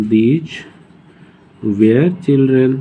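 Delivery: put-on these were children drawn out word by word; very slow repeat. Shown as a demonstration. Teacher repeats these were children slowly for students. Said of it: "अरे हाँ".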